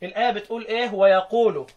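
ordinary talk, nothing marked

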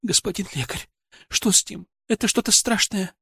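high, frightened woman's plea; tearful, faltering voice. A narrator's reading. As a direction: beg the doctor for an answer, close to tears. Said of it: "Её голос дрогнул от подступающих слёз". (испуганно, сквозь подступающие слёзы)

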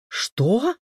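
high, loud rising gasp of a question; shocked, shooting up in pitch; horrified exclamation. A narrator's reading. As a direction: exclaim in renewed horror. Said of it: "воскликнула Мария, и её глаза снова округлились от ужаса".

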